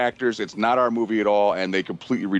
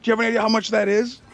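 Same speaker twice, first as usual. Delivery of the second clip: mock shock; 11 semitones higher than usual. Teller reacting to porn thrown out, mock shock. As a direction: play up fake disbelief, pitched high. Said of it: "I'm like".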